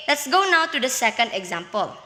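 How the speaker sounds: ordinary delivery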